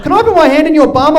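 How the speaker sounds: high, loud imitated little boy; five-year-old at max volume. Son asking dad in the shower, loud high child voice. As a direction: blurt it out like a kid with no filter. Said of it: "speaks at max volume".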